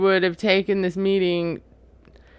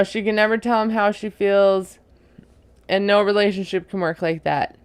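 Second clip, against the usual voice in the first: ordinary talk, nothing marked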